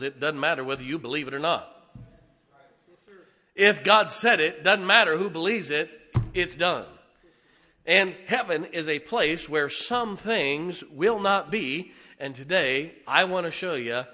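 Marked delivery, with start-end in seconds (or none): 3.5-14.0 loud, raised preaching voice; emphatic preaching. Real, not acted. Preacher telling congregation what God said settles it, emphatic. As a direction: drive each phrase home, loud.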